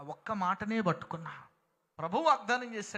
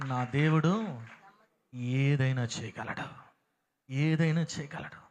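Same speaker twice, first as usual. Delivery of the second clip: lower, louder, slow proclamation; preaching; 5 semitones lower, 5 dB louder, slower than usual. Acted. Preacher declaring to the congregation, slow and loud. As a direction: proclaim it with weight.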